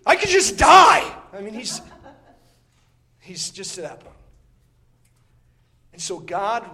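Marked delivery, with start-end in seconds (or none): 0.1-1.2 higher, louder and slow, a whiny exaggerated wail; hammed-up angry Jonah. Preacher mocks Jonah's sulking, higher, louder, slow wail. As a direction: ham up Jonah's overblown despair. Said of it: "he says".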